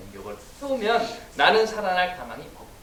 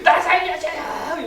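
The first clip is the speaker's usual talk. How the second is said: much higher, slow, louder wailing plea; desperate cry for help; 10 semitones higher, 7 dB louder, much slower than usual. Retold or imitated. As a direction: beg for your life, hammed up. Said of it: "이렇게 될 거예요".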